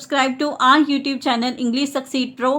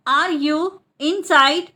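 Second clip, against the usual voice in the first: split repeat. Shown as a demonstration. Teacher repeats the question for learners, split in two. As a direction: pause between "Are you" and "inside".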